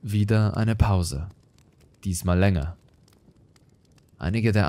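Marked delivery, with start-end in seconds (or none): none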